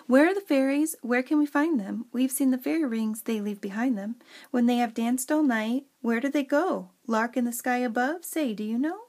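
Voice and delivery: lilting storybook verse recital; expressive fairy poem read aloud. Acted. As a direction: read the rhyme with a singsong, questioning lilt.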